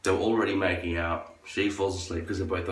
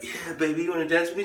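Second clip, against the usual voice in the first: mock flirty guy; 10 semitones higher than usual. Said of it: "they'd be like".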